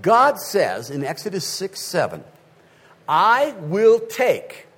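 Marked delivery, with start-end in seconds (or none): 3.1-4.7 voicing God. Pastor voicing God in Exodus.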